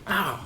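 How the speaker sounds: sharp high yelp of real pain; burned hand. Real, not acted. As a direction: yelp as the solder burns your fingers. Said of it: "I just burned my hand".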